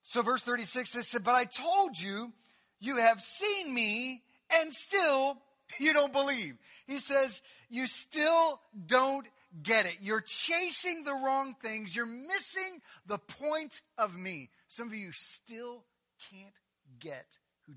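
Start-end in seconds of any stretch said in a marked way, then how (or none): 1.2-6.6 slow emphatic. Acted. Preacher voicing Jesus, slow emphatic. 7.7-14.5 slow pleading. Acted. Preacher voicing Jesus paraphrase.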